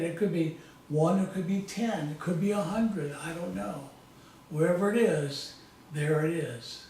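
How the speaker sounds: calm, measured hypnotic storytelling voice; guided hypnosis. Acted. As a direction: lead them gently through a visualization, pausing often. Leads a guided visualization.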